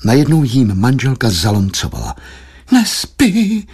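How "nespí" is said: high lamenting cry; wife's high woeful complaint; wailing. A narrator's reading. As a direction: wail it in dismay, pitched up. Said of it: "zabědovala".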